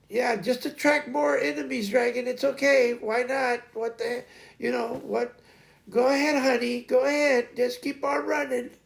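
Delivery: high-pitched mock-sweet parent giving up; sarcastic encouragement. Mock encouragement to runaway Dragon.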